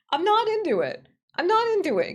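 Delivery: high, loud objection, said twice; indignant protest. Real, not acted. Streamer rejecting the ghost graphic, indignant protest.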